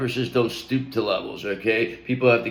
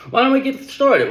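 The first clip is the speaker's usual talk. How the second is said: excited burst; 12 semitones higher, 5 dB louder than usual. Real, not acted. Excited start of reading.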